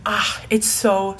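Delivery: ordinary talk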